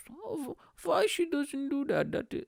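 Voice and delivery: put-on high-pitched dad voice slipping into Indian accent; botched accent, high and Indian-sounding; accidental Indian accent. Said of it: "Why am I doing an Indian accent?".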